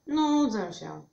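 loud bored moan; complaining. A narrator's reading. Bored Witek to his hedgehog friend, complaining. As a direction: complain with drawn emphasis. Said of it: "poskarżył się Witek".

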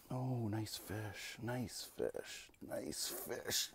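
very soft, slow murmur of nice fish; hushed praise. Real, not acted. Angler softly admiring the walleye, near whisper. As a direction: barely voice it, almost to yourself.

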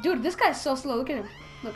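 normal talking voice

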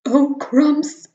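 a snake caught off guard; alarmed snake. Acted.

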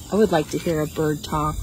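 normal talking voice

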